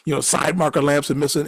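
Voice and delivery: usual speaking voice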